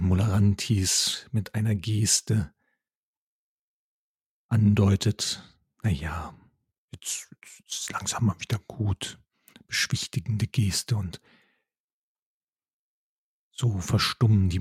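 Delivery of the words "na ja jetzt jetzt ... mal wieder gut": soft whispered calming. Acted. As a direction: hush them gently, almost breathless. Mole calms the worshippers.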